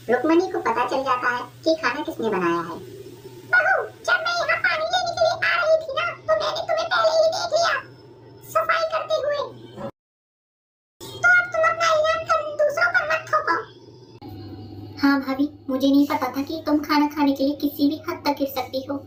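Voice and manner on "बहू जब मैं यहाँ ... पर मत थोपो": high, put-on old woman's voice; squeaky elderly saas, grumbling; old mother-in-law. (ऊँची आवाज़ में बूढ़ी सास)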